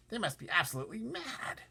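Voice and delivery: high, brash centipede. Acted. Centipede mocking the cloudmen, high and brash. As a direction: pitch it up, cocky. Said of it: "the centipede said".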